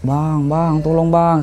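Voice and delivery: a hint of a desperate shout; retold cry for help. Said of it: "Saya teriak".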